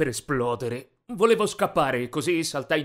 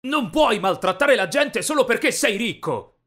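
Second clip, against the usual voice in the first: high, loud outraged shout; 9 semitones higher, 8 dB louder than usual. A narrator's reading. Son defending his father from the rich bully, outraged yell. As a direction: shout in righteous indignation. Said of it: "Gli gridai".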